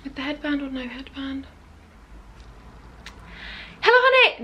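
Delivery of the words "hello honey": high, loud sing-out hello; over-bright channel opener; vlog intro greeting. Shown as a demonstration. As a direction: put on a big bubbly intro voice. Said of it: "No, that is awful".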